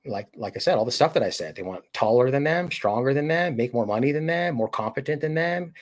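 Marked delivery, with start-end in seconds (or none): none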